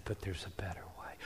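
soft, fast whisper; God's whispered reassurance to the girl; whispered comfort. Retold or imitated. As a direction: breathe it like a gentle secret. God speaks gently in vision.

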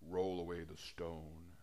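movie Jesus. Retold or imitated. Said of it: "Jesus is like".

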